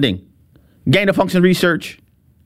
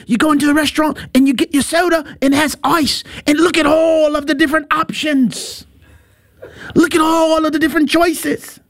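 awed tourist; 6 semitones higher than usual. Retold or imitated. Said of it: "the one guy was like".

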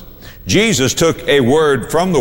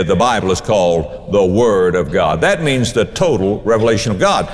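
normal talking voice

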